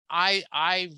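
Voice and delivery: normal talking voice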